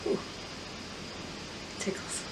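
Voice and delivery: breathy whisper. Real, not acted. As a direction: whisper it, nose tickling. Nose tickling before sneeze.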